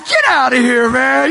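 high, loud imitated rebuff; mock disbelieving shout. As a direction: holler it in playful disbelief. Acts out friend's startled rebuff.

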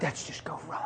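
whispered dismissal; barely voiced nudge; hushed reply. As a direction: whisper it low and close. Holy Spirit's hushed reply.

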